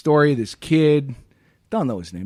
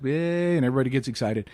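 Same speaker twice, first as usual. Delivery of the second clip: usual speaking voice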